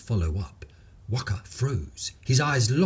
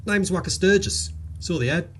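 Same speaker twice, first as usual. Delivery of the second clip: high, easy-going Aussie bloke voice; lightly voiced Australian bloke; cheerful Queensland bloke; 7 semitones higher than usual. Waka's voice.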